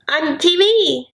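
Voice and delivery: high, silly put-on reply; imitating Brittany. Girlfriend answering her online suitor, high silly imitation. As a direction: toss it back in a high mock voice. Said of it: "She's like".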